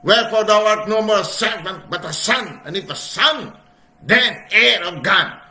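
loud, high proclaiming read; scripture reading. Acted. Preacher reading King James aloud, loud and high. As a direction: proclaim the verse to the congregation.

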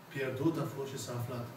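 ordinary delivery

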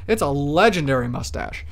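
normal talking voice

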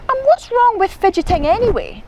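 high, quick indignant question; indignant protest. A narrator's reading. Izzy defends her fidgeting, indignant. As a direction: challenge it like a sulky child.

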